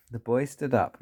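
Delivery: ordinary delivery, nothing marked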